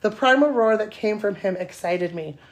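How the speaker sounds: steady read-aloud narration; story reading. Acted. Reading her steamy story to podcast cohosts, even narration. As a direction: read the romance scene out to the room.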